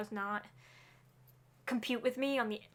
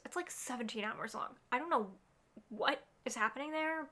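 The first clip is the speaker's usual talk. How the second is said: normal talking voice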